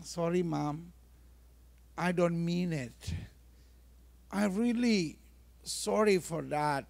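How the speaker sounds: soft, halting child's apology, lightly acted; mock tearful apology. Child apologising to mother over the broken vase, understated tearful. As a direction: gently imitate a sorry child, quiet and subdued. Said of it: "tiba-tiba anak ibu nangis".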